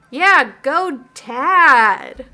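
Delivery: loud, drawn-out cheer. Acted. Dad cheering himself on after his advice, loud drawn-out whoop. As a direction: whoop for yourself like a fan.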